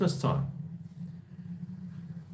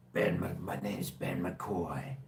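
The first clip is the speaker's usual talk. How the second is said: slow, soft American drawl; American accent; 6 dB quieter, slower than usual. Shown as a demonstration. Vlogger showing off his practised accent, American drawl. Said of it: "I've been practicing my American accent".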